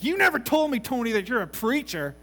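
high, loud outburst of disbelief; astonished accusation. Retold or imitated. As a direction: blurt it out as a loud reproach. Harry's astonished accusation in story.

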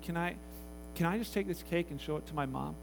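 normal talking voice

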